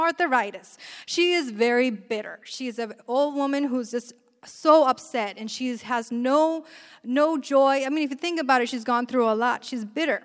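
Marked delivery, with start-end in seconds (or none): none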